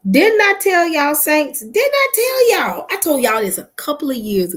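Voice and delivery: high, loud told-you-so call to the Saints. Real, not acted. Vlogger calling out to her followers, loud and high. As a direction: belt it out, I warned you. Scolding followers for not listening.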